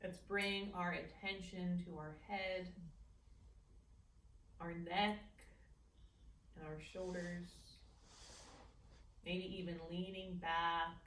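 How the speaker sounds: calm guided meditation voice. Acted. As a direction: ease them gently into the practice. Leads a meditation.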